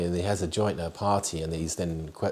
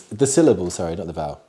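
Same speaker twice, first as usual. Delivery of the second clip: normal talking voice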